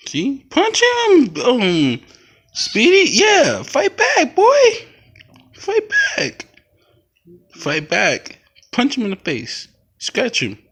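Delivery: high, loud cheering on the cats; cheering on a fight. Real, not acted. Owner cheering her kitten at a cat fight, high and loud.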